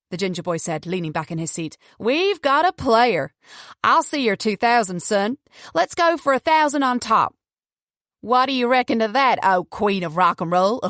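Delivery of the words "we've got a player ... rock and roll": put-on high, loud, swaggering poker kid; cocky Southern boy, goading. A narrator's reading. Cocky boy raising the stakes against his rivals, loud goading. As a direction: crow over the bet as a brash young gambler.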